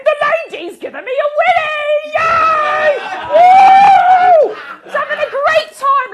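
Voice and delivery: put-on high, loud, whooping little boy; kid's gleeful yelling. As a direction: shout it like a thrilled kid running around. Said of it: "he proceeds to just run around going".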